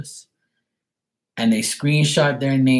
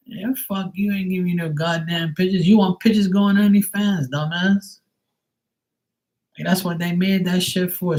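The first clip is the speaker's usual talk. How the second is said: mocking retort; 6 semitones higher than usual. Sister's retold retort to guys.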